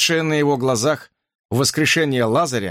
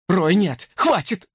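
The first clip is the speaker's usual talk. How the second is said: high, pleading scream; frantic shout; desperate cry; 5 semitones higher, slower than usual. A narrator's reading. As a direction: beg him to stop. Brook screaming at creature.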